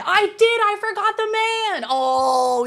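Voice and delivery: high, loud exclamation at your own slip; high-pitched "oops, I forgot" outburst; sudden realization. Real, not acted. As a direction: blurt it out, dismayed at forgetting. Realizes they skipped part of story.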